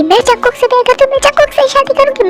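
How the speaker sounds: high and fast, insisting; heated declaration. Acted. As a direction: rush it out high, refusing to back down. Daughter declares love to parents. (ऊँची, तेज़ ज़िद भरी घोषणा)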